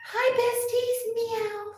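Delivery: tiny high-pitched kitty voice; cute cat voice. Pretend. Owner making the cat greet her besties, cute cat voice. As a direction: squeak it sweetly as the pet.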